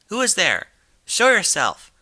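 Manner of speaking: high, loud, frightened cry; nervous scream. Acted. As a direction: yell into the dark to hide her fear. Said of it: "she screamed nervously".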